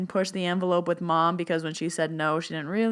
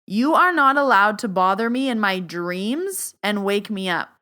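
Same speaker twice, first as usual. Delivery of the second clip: loud, stern warning; like angry; 5 dB louder than usual. Shown as a demonstration. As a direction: demonstrate an angry boundary, forcefully. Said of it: "it's like I'm angry".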